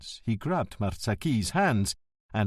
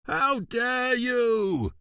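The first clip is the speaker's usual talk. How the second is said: faked outrage for the staff to hear; bawled; 12 semitones higher, much slower than usual. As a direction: bawl as if fighting off an attacker. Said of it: "he bawled".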